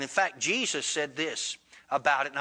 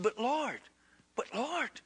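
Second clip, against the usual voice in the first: soft, higher-pitched retold plea; hushed pleading; 6 semitones higher, 6 dB quieter, slower than usual. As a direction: falter softly in protest to the Lord. Disciple pleads for the damned woman.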